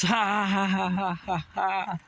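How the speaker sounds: imitating a taunting laugh; mock laughter. Data scientists mocking viewers, imitated taunting laugh. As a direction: fake them laughing at you. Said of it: "They just".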